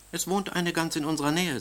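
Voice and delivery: the queen confiding to her husband, lightly voiced; queen's voice. A narrator's reading. Queen tells king about witch.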